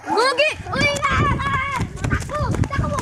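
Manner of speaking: urgent shout to run. Real, not acted. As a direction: yell for everyone to run. Dog chasing, kids flee.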